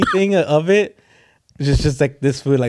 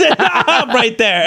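laughing; 7 semitones higher, slower than usual. Retold or imitated. Laughs through the retold line.

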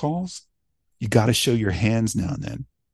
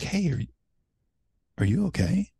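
softer, gentle real check-in; natural concern; 6 semitones higher, 5 dB quieter than usual. Retold or imitated. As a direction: ask quietly like you truly care. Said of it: "No, you're gonna be like".

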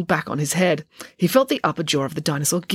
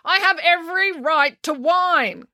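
high, indignant protest; yelling; 11 semitones higher than usual. A narrator's reading. Finn defends his whining, yelling. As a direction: yell it high and drawn out. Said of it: "yelled Finn".

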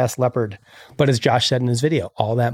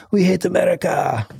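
higher, put-on character voice; mock anti-American culprit; 5 semitones higher than usual. Host imagining the eagle shooters plotting, mock raised voice.

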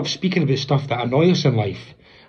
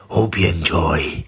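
creepy; 9 semitones lower, slower than usual. Shown as a demonstration. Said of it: "That sounded total creepy there".

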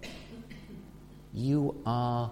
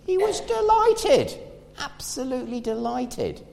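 delighted; 12 semitones higher than usual. Acted. Peter's joy.

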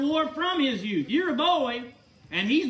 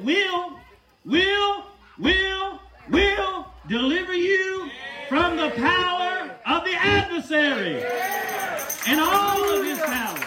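preaching at full force; 6 semitones higher, slower than usual. Real, not acted. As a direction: proclaim it, building to a shout. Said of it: "preaching climax on deliverance".

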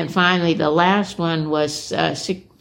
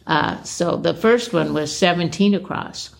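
normal talking voice

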